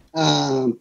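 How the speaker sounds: normal talking voice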